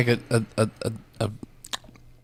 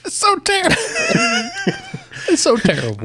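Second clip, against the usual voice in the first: laugh-crying. Real, not acted. Laughing at the joke.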